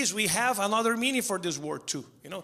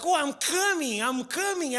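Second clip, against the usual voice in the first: mock excited moan; 7 semitones higher than usual.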